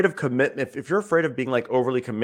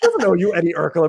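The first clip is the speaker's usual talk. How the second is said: laughing disbelief; 5 semitones higher, 5 dB louder than usual. Real, not acted. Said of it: "laughing disbelief".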